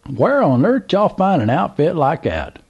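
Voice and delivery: put-on high, excited drawl of an old country man; old Southern gentleman. A narrator's reading. Old man calls to trucker, high Southern voice. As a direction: play the curious old man at the gas pump.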